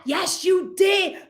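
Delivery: high, drawn-out accusing shout; insistent accusation. Acted. Critic insists you meant it, high accusing retort. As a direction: shoot down the denial, stretching it out.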